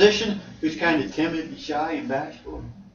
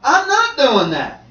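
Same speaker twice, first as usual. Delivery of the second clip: high indignant Job; 5 dB louder than usual. Acted. Job answers his wife.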